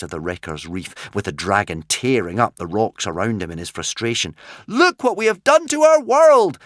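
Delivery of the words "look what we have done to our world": high, loud anguished shout. A narrator's reading. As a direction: shout over the devastation. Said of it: "shouted Hiccup".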